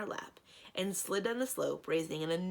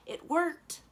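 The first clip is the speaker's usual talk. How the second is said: excited; 11 semitones higher, 5 dB louder than usual. Acted. Cheerful announcement, high and loud.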